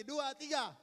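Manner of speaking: rally the congregation; energetic count. Shown as a demonstration. Pastor rousing the church to read angrily. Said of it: "Ayo baca sama-sama sekali lagi".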